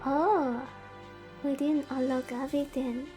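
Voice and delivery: ordinary talk, nothing marked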